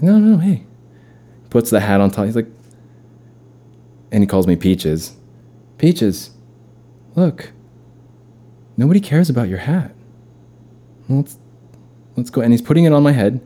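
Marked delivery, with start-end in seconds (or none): 0.0-0.6 high, loud coach call. Retold or imitated. Brother in coach voice refusing the hat. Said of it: "like he's Vince Vaughn". 5.8-12.4 high, breezy coach talk. Retold or imitated. Brother as coach coaxing Peaches inside. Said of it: "He gave me the, the Little League baseball coach".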